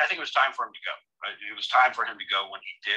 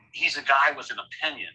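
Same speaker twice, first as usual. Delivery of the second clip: ordinary talk, nothing marked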